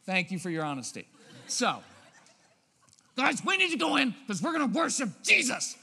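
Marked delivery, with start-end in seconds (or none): 3.2-5.8 put-on loud, high, exasperated bark at the kids; frustrated parent yelling. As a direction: herd the kids out with a mock yell.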